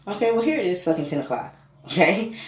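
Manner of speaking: okay well here it is, exasperated; exasperated outburst. Real, not acted. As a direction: blurt it out, here we go. Exasperated at the late hour.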